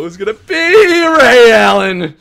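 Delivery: loud, slow, drawn-out hype; real slow excited shout. Real, not acted. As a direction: call it out like a hype man. Big pull reveal.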